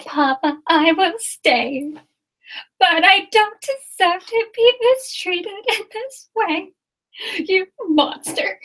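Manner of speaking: high, slow, far-off theatrical heroine voice defying a monster; dramatic heroine. Acted. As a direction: declaim like a stage heroine, slow and lofty. Acting out a Beauty and the Beast scene.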